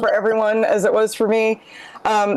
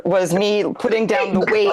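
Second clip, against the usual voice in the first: ordinary talk, nothing marked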